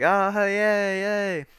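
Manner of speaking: a light sarcastic celebration; mock cheer. Family admiring the closed door, mock cheer. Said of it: "We're like".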